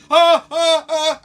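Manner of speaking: high loud mock cries of pain. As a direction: yelp like the leg hurts.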